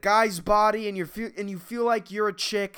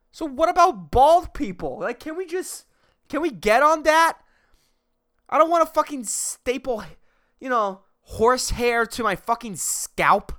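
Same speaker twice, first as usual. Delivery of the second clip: exasperated rant; 8 semitones higher, 4 dB louder than usual. Real, not acted. Ranting demand for baldness cure.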